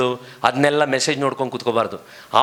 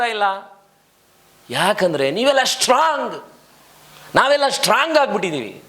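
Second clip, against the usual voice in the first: full-force sermon climax; fiery preaching; 11 semitones higher than usual. Acted. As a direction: press the congregation hard.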